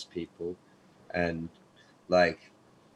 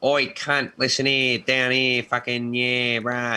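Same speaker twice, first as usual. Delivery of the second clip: hammed-up sweary Aussie bogan, pitched up; loud, raised, broad Australian mock-yob; Big Les; 4 semitones higher, 4 dB louder, slower than usual. Shown as a demonstration. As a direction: call out in an exaggerated Aussie voice. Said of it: "like, Big Les".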